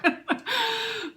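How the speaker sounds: a high, broken laugh on the edge of tears; real laugh choking into crying; tearful high laugh. Real, not acted. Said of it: "maybe I'm gonna cry".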